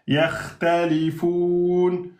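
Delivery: yakhtalifoun slowly, with a long-held "foun"; long pausal hold. Shown as a demonstration. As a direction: stretch the last syllable of yakhtalifoun for several beats. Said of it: "quatre ou six temps".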